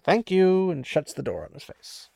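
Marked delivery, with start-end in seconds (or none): 0.0-0.7 curt thank you. Retold or imitated. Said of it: "and he's like".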